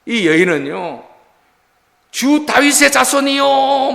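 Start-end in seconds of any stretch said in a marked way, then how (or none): 2.1-3.9 crying out. Acted. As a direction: call out to him as Messiah, drawn out. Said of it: "막 이러면서 외쳤던 거예요".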